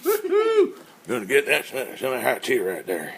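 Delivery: squeal, then put on a goofy voice; character voice. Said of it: "You should do that thing".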